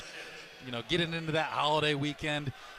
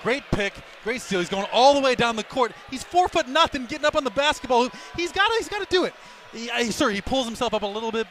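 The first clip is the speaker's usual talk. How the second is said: much higher, louder and excited; fired-up defense; 7 semitones higher, 6 dB louder than usual. Real, not acted. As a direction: cheer the little guy on. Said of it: "I'm so fired up".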